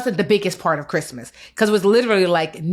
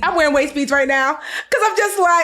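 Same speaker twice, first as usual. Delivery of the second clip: excited; 8 semitones higher, 4 dB louder than usual. Real, not acted. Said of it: "laughing at her own splurge".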